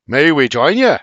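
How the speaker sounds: put-on offered in a hearty Irish accent. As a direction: ask it in an Irish lilt. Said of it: "The man's Irish accent".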